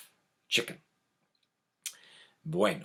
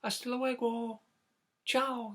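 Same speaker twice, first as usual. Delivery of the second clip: high chick goodbye; 10 semitones higher, slower than usual. Acted.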